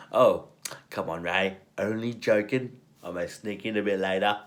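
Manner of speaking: lightly voiced cheeky charmer backing off; imitating Fletcher. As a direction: laugh it off, still teasing. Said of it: "Charlie picks up his gun and is like".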